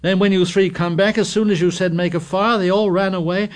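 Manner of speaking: high, loud, rushing protest; indignant defence. A narrator's reading. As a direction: climb into outrage.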